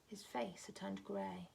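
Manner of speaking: plain reading voice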